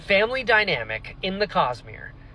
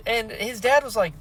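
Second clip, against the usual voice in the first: ordinary talk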